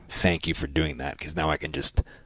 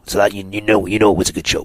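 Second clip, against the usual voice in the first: punchy ring announcer delivery; 4 semitones higher, 5 dB louder than usual. Shown as a demonstration. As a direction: sell it like a fight announcer. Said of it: "That's my announcer voice".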